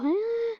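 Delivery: high-pitched drawn-out "ah"; high wail. Retold or imitated. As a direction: let out a high, acted wail. Acted cry of dying baby.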